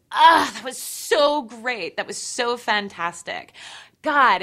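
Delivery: loud, slow, self-satisfied sigh; smug boyfriend. Retold or imitated. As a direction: ham up his smug afterglow. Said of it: "afterwards going".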